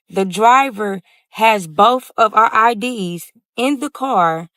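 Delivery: ordinary delivery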